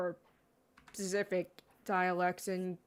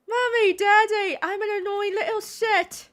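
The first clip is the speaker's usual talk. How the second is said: put-on much higher, whiny little girl voice; mocking Peppa Pig; 13 semitones higher, 6 dB louder than usual. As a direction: whine like a spoiled cartoon pig. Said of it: "The whole series is annoying as hell".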